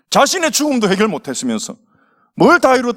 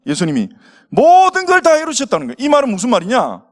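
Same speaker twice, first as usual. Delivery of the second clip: ordinary talk, nothing marked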